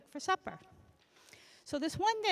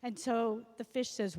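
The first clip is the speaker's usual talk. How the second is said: ordinary delivery, nothing marked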